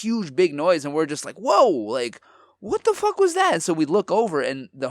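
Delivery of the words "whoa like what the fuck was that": high startled yelp. Retold or imitated. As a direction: jump at the sudden bang. Startled by a loud bang.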